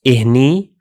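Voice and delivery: إهني said clearly as "ih-ni", rising at the end; Arabic demo word. Shown as a demonstration. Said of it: "like this".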